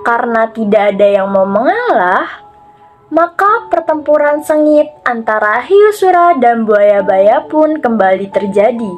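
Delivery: usual speaking voice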